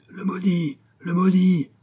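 high, falling cries of hatred; many-voiced curse, cried out; hateful shouts. A narrator's reading. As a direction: call it out like a vengeful crowd. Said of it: "lui crièrent sans voix haineuse".

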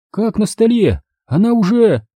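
high, startled woman's voice; alarmed woman. Acted. Tatiana blurts out in shock about Galina.

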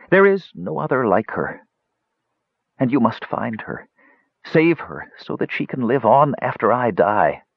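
put-on high, earnest old wizard; pleading dying mage. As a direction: beg on behalf of the one you love. Dying mage pleads to save Brandella.

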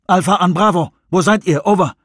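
radio call. A narrator's reading. Jones radioing the other team, crisp radio call.

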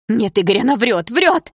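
high, loud protest climbing to a cry; frantic denial. A narrator's reading. Distraught girl denies the death.

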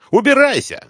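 high, loud furious yell. Acted. Woman yells at the detective to get out, shrieking. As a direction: order him out at full voice. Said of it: "и заорала".

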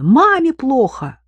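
high, loud and slow; alarmed shout. A narrator's reading. Daughter calling her brother about mum, shouting. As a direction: cry out the alarm. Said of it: "закричала она".

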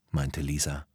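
usual speaking voice

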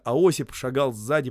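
ordinary delivery

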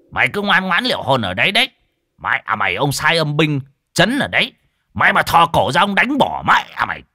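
high, loud warning, fast at first; stern threat. Acted. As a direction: warn the spirit to stay put. Exorcist threatening the ghost. (thầy Toàn đe dọa vong, giọng cao, to)

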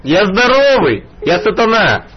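ordinary delivery

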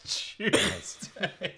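put-on very slow, high British accent echo; mock British accent. Co-host echoing the joke, drawn-out British accent. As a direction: stretch out the mock British words. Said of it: "What does a British person sound like".

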